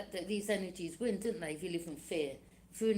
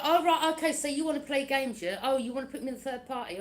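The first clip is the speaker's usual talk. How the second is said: sarcastic; 5 semitones higher, 5 dB louder than usual. Retold or imitated. Mocking enemies playing games.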